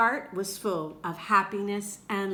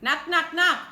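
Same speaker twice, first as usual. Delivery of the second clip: mock knocking; 7 semitones higher, 7 dB louder than usual. Narrator knocking at Omu's door again, high and loud.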